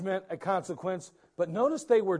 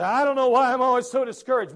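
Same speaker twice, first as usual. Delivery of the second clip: higher, louder whine; complaining person; 5 semitones higher, 7 dB louder, faster than usual. Retold or imitated. A churchgoer complaining to the pastor, whiny. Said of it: "People say".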